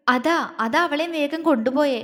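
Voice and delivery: plain reading voice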